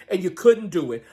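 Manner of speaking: ordinary talk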